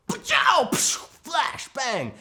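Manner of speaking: loud, high shouted zap sounds; mock flash bang noises. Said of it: "he's like".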